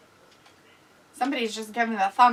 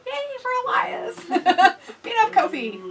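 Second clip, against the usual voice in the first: much higher mock cheer for the winner; 9 semitones higher than usual. Cheering the wrestler nobody likes, high mock cheer. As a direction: cheer him on in a high, hammed-up voice. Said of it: "But we're gonna go".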